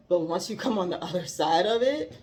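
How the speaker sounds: normal talking voice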